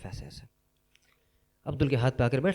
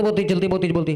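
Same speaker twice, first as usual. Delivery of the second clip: put-on loud complaining girl; 4 semitones higher, 5 dB louder, faster than usual. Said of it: "बेटा धीरे बोलो, बहुत तेज बोल रही हो".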